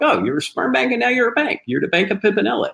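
high-pitched jokey ribbing of a relative; joking tease. Retold or imitated. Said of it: "it was kind of a joke".

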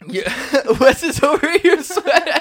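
high loud laughing words. Real, not acted. Laughing at sweating friend.

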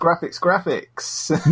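slow, high mock echo; echo effect. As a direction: fade the word out like an echo.

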